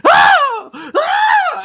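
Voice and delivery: high, loud mock screams; screaming. Passengers screaming as the plane drops, mock scream. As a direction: imitate panicked passengers yelling. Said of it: "people are hollering, screaming".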